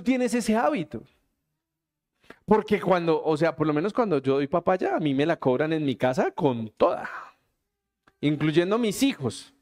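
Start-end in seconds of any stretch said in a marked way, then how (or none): none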